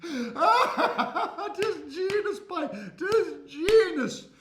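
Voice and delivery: high, loud, very slow "'Tis genius" through laughter; laughing shopkeeper. Acted. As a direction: laugh it out as you go.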